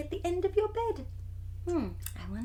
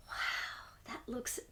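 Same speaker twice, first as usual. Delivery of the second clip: whispering; 3 semitones lower, 11 dB quieter, slower than usual. Real, not acted. Awed at picture.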